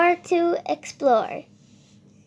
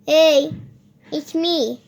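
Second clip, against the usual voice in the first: ordinary delivery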